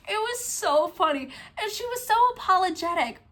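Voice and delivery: high-pitched voice left over from laughing; real amusement. Real, not acted. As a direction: still tickled, talk through the giggles. Recovering from laughing at her story.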